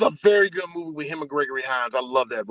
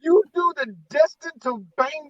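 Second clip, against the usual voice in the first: incredulous; 8 semitones higher than usual. Real, not acted. Mock disbelief at movie's plot.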